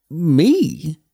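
put-on startled young Mort; surprised boy.

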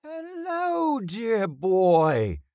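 very high, theatrical greeting; old thespian's cry. A narrator's reading. Old actor greets boy behind him, crying out. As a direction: hail him grandly from the stage. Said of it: "cried the old one".

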